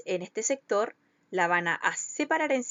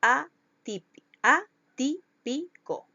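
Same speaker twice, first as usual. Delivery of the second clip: "atip-, atípico" slowly broken into separate, higher syllables; 4 semitones higher, 4 dB louder, much slower than usual. Shown as a demonstration. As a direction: sound out "atípico" slowly, piece by piece. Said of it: "Llevan el acento en la antepenúltima sílaba". ("atípico" lento, separado en sílabas)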